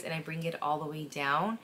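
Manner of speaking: ordinary talk, nothing marked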